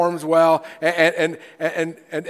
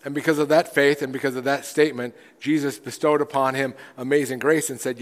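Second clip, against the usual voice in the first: normal talking voice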